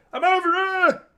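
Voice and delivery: high, loud mock shout of players wanting the ball; players calling for the ball. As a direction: squeal like a teammate begging for a pass. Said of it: "all going".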